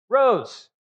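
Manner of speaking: rose punched high and loud, falling; stress on rose. Shown as a demonstration. Preacher stressing that Jonah rose, to his congregation. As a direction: lean hard on the word rose. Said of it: "Notice Jonah's response".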